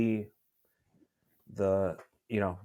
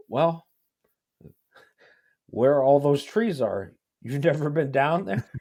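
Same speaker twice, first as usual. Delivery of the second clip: exasperated witness; 4 semitones higher, 7 dB louder than usual. Retold or imitated. Witness answers the lawyer, exasperated.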